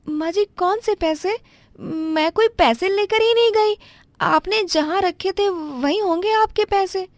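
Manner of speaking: pitched well up, flustered daughter-in-law apologizing; young daughter-in-law. Acted.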